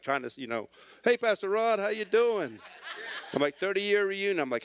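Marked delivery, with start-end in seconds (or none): 1.0-2.6 high, loud imitation of a reveler; hammed-up boisterous hello; tipsy greeting. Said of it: "kinda stumbling a little bit". 3.6-4.4 defensive blurt. Retold or imitated. Repeating his flustered excuse, retold.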